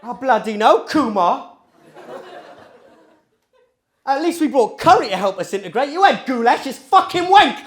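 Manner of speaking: loud, high Cockney rant; strong Cockney accent. Retold or imitated. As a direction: rant as a put-on Cockney cousin. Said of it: "my two cousins have strong Cockney accents".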